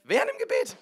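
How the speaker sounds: high, loud comic indignation; mock outrage. Preacher finds the man left mid-prayer, mock outrage. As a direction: exclaim it in disbelief.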